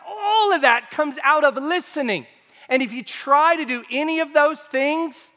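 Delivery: high-pitched, emphatic sermon warning; forceful preaching. Real, not acted. Preacher warns with rising force.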